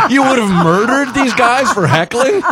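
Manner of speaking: real laughing alarm at the gun remark; laughing disbelief. Real, not acted. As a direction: crack up in shocked disbelief. Shocked at concealed carry.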